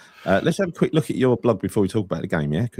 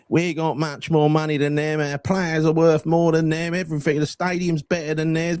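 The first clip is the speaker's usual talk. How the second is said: mocking loudmouth fan; 9 semitones higher than usual.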